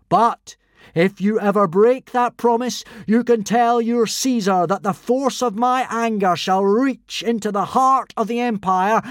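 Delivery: loud, high victorious proclamation; triumphant speech. Acted. Fake god's final speech to the Romans. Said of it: "made his final speech".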